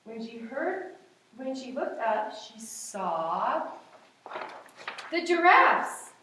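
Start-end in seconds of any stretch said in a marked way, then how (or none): 5.1-6.1 loud, high, slow announcement; excited reveal. A narrator's reading. Teacher reading to children, loudly revealing the giraffes. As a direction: stretch it out for a big surprise.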